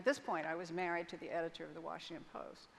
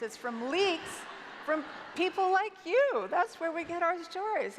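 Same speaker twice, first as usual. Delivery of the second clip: higher, louder incredulous retort, building to a peak; retold amused incredulity; 7 semitones higher, 6 dB louder than usual. Retelling her answer to the FBI men, incredulous. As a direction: throw the question back, climbing higher and louder. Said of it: "burst out laughing, and I said".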